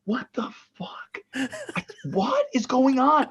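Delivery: slow, whispered shock of the wife. Retold or imitated. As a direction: hiss it, stunned by the family fighting. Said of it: "My wife's like".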